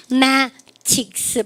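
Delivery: MATHEMATICS drawn out, broken into slow syllables. Shown as a demonstration. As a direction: split "mathematics" slowly into separate chunks. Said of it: "발음 그러니까 mathematics".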